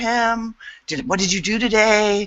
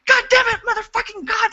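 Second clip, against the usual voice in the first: retold furious curse. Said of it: "just saying".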